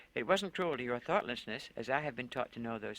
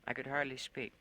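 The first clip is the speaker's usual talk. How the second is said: ordinary delivery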